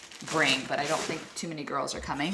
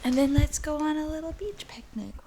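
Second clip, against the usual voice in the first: cooing to the dog; 10 semitones higher, 5 dB quieter than usual. Real, not acted. Talking to her dog, high cooing pet voice. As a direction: coo at your little pup, much higher.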